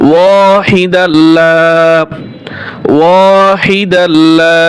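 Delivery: ওয়াহিদাল্লা drawn out slowly; slow recitation. Shown as a demonstration. Teacher modelling the lengthened wa. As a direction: hold each vowel of ওয়াহিদাল্লা. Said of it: "টান হবে".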